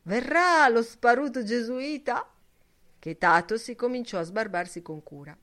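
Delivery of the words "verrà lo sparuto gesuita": loud, higher mocking yell. A narrator's reading. Shouting the taunt down toward Steven, loud and high. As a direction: bellow it out laughing. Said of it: "urlò".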